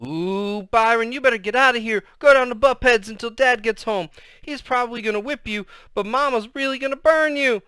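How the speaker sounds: put-on high, loud, alarmed kid; urgent warning. A narrator's reading. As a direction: warn big brother frantically to flee. Kid urging brother to flee.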